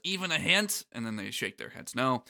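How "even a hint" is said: lightly voiced cartoon duck, pitched up; Donald. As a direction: ask it as a hopeful duck. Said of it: "Donald says".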